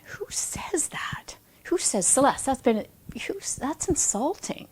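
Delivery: high whispered outrage; incredulous inner voice. Retold or imitated. Scene: leaving the client's house, her judging mind in a high indignant whisper. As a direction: breathe the disbelief, high and hushed.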